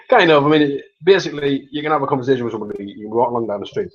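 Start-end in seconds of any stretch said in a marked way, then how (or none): none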